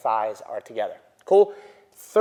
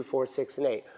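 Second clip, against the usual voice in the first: ordinary delivery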